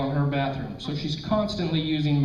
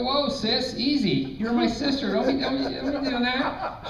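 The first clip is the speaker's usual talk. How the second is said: nervous covering; 8 semitones higher than usual. Retold or imitated. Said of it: "And I go".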